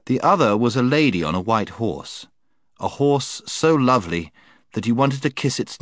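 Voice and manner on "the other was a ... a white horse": plain reading voice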